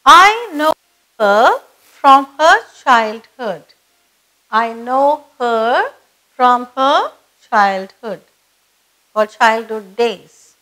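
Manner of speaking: slow, deliberate reading with pauses between phrases; measured repeat-after-me delivery, phrase by phrase; slow model reading. Shown as a demonstration. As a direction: read slowly for learners to repeat. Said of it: "read it slowly so that you repeat".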